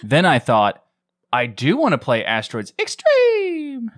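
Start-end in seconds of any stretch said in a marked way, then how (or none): 1.3-3.9 excited. Real, not acted. Excited about game title.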